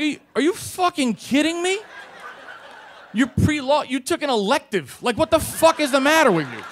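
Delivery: high-pitched, incredulous telling-off; acted exasperated outburst. Officer berates pre-law student, acted exasperated outburst. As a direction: berate him, pitch soaring.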